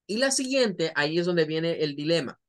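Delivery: ordinary delivery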